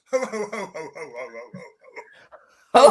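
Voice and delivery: jolly Santa ho ho ho; Father Christmas. Shown as a demonstration. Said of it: "Now your best Father Christmas".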